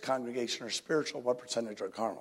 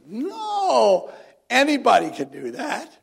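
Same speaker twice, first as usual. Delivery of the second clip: emphatic denial; 11 semitones higher, 10 dB louder, slower than usual. Acted. Mock dismissal of theology.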